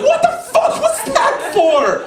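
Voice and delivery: high, drawn-out cry of protest; outraged mock yell. Brother just slapped by his sister, outraged yelp. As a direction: stretch it into a high indignant yelp.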